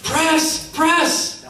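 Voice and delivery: high, urgent coach's call; coach yelling press. Retold or imitated. Coach calling for a full-court press. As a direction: bark it like a coach on the sideline. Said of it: "The coach stands up and says".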